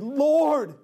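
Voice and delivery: urgent father's appeal; pleading. Acted. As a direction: beg desperately, stretching the words. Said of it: "the official pleaded".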